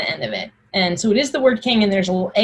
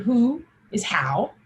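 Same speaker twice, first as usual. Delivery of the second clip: ordinary talk, nothing marked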